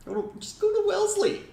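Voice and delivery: very high, quick cartoon voice; squeaky Family Guy quote; Adam West impression.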